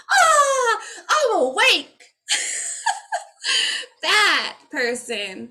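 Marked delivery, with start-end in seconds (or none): 0.1-2.1 very high, loud, slow sigh of awakening; mock awakening. Showing the awakened person, high and exalted. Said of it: "being like". 2.3-4.0 very high unvoiced giggle; high whispery laugh. Real, not acted. Tickled by her own impression, an airy giggle.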